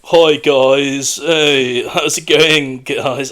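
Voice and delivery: exaggerated campus-student impression; awful student voice. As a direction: greet like an insufferable UK student. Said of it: "it's like this awful student voice".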